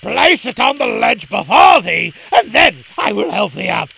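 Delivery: very loud, forceful command; beginning to rage. Acted. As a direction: dervish bellowing terms at the boy. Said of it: "and beginning to rage".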